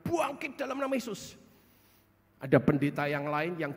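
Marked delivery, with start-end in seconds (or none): 0.1-1.4 imitated prayer. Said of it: "Ya, kira-kira".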